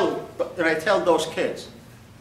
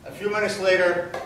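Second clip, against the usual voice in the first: normal talking voice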